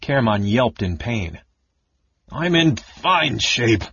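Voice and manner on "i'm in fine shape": slow, sulky grumble; muttered defensive protest; angry mumble. Acted. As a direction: Karaman grumbling while squeezed into armor. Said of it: "the big man mumbled angrily".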